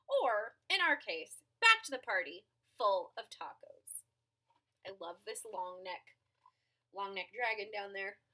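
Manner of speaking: usual speaking voice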